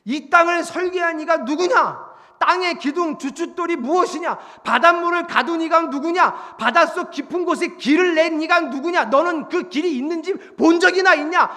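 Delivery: loud, high volley of questions; God cornering someone with challenges; relentless interrogation. Acted. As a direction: drive each question at the listener. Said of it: "갑자기 욥한테 막 질문으로".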